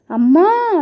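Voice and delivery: a sudden high yelp of pain; cry of pain. A narrator's reading. Woman pulled back by her hair, a high pained yelp. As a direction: yelp as if your hair were yanked. Hair yanked, she falls.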